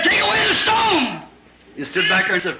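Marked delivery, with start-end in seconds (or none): 0.0-1.2 high slow ringing command; commanding call. Acted. Jesus commanding those at the grave, high slow call. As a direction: call it out like Christ at the tomb.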